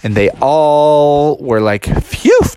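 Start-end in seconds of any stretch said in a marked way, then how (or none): 2.0-2.4 high, loud whew; exaggerated sigh of relief; relieved phew. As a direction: let out a big phew. Relief after dragon is gone.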